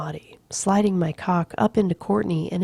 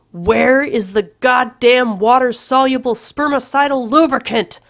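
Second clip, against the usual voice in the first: loud, higher furious yell; scream; 6 semitones higher, 8 dB louder than usual. A narrator's reading. Patrick screaming at Courtney from the bathroom, loud. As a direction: yell it in frustration. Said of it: "I scream".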